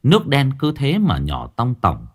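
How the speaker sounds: ordinary delivery, nothing marked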